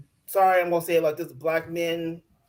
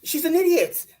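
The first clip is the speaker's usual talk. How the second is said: exasperated; 10 semitones higher, 3 dB louder than usual. Real, not acted. Exasperated rant at cast member.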